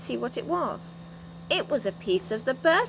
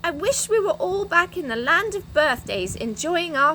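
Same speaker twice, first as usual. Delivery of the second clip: eager; 8 semitones higher, 4 dB louder than usual. Acted. Franny eagerly making the wish.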